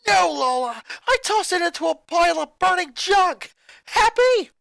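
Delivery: high, loud crying yell; furious yell. Acted. Boy holding his sister by the collar yells in fury. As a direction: hurl it at her in rage. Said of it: "he yelled".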